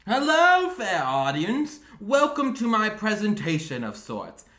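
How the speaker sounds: high, loud showman's greeting; flamboyant Sterling. Acted. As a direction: project it grandly to a whole theatre. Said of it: "you just be Sterling 'cause you're flamboyant".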